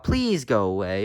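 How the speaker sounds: ordinary delivery, nothing marked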